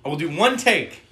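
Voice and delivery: high, loud, slow on "one take"; grudging insistence. Acted. Playing the reluctant actor agreeing to the movie, insistent. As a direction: lay down terms as a put-upon actor. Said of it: "his character just being like".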